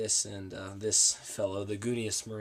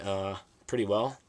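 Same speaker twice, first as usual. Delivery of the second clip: ordinary talk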